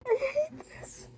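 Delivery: very high tearful wail; real high-pitched anguish; heartbroken. Real, not acted. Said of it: "I'm heartbroken".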